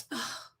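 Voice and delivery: very soft choked "oh"; faint mock sob under the breath; imitated tearful gasp. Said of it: "That girl going".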